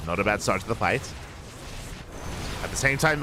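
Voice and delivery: ordinary talk, nothing marked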